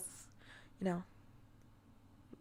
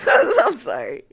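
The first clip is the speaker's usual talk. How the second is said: loud slow apology through laughter; laughing apology; 5 semitones higher, 17 dB louder, slower than usual. Real, not acted. Streamer laughing an apology to chat. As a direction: apologize while cracking up.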